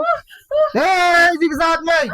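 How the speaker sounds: high, drawn-out commanding yell; urgent shouted command. Real, not acted. Exorcist hurrying helpers during the struggle, shouting. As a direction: call out hard to spur them on.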